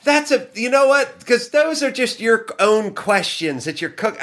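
very high, flustered protest; mock defensive retort. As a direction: blurt her excuse in a high mock voice. Acted flustered defensive girl.

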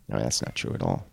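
quiet aside. Real, not acted. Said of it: "correcting himself dryly".

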